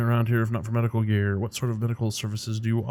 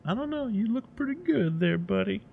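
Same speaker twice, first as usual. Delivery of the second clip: much higher, softer sing-song; mock-sweet falsetto compliment; teasing falsetto; 11 semitones higher, 4 dB quieter than usual. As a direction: flirt with the customer in a squeaky voice. Character teasing the customer.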